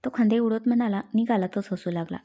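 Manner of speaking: ordinary delivery, nothing marked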